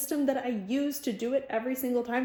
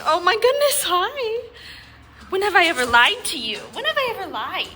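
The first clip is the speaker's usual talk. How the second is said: excited dog talk; 9 semitones higher, 11 dB louder than usual. Real, not acted. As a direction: gush over the happy dog.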